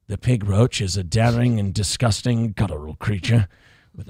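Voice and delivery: low, hushed wildlife documentary narrator; nature documentary narration. Acted. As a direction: narrate like a nature film, deep and measured. Nature documentary parody about DSP.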